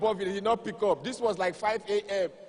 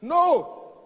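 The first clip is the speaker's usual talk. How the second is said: shout; 10 semitones higher, 9 dB louder than usual. Acted. Emphatic shouted refusal.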